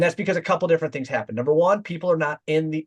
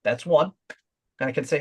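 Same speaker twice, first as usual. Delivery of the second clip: ordinary talk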